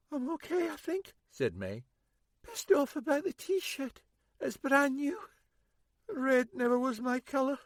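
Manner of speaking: a hurt woman brushing it off; wounded woman, wry. A narrator's reading. Injured woman's voice.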